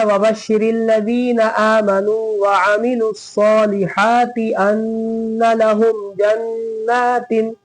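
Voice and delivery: slow, drawn-out chanted recitation; Quran recitation. Shown as a demonstration. Teacher reciting for students, slow and melodic. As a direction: recite slowly, stretching each vowel.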